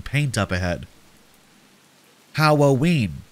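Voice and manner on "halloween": lightly voiced excited little brother; a touch of childish excitement; excited little boy. A narrator's reading. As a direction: say it as a small, eager child. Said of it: "jumping up and down excitedly".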